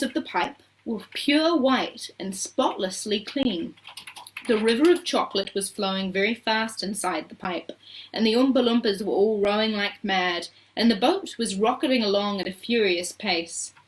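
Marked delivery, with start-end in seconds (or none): none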